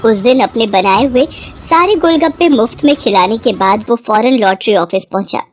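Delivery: ordinary delivery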